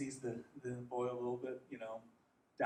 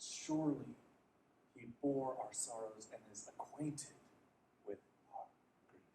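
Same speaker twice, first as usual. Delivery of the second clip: soft unvoiced whisper; hushed scripture; 5 dB quieter than usual. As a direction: breathe the verse reverently. Scripture quoted tenderly.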